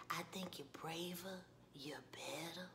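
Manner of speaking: low, whispery, tender praise; Ralph Angel. Retold or imitated. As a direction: murmur it intimately, voice fading. Voices Ralph Angel praising Darla.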